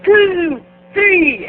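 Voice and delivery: loud high chanted count; crowd counting. Retold or imitated. Whole bar counting the squats, loud high chant.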